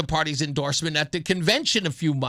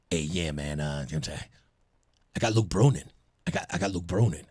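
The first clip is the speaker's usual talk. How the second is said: much lower, laid-back buddy voice on the phone; friend on phone; 12 semitones lower, 5 dB quieter, faster than usual. Retold or imitated. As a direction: drop way down into your buddy's phone voice. Friend's late-night call retold.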